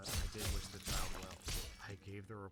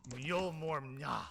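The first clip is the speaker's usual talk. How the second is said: attempted Irish; 8 semitones higher, 6 dB louder, much slower than usual. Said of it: "which I'm gonna give a stab at".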